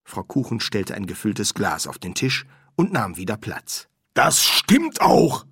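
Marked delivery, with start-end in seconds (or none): 4.1-5.4 high, loud and drawn out; slow, raised, emphatic assertion. A narrator's reading. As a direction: insist slowly and loudly. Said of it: "betonte sie".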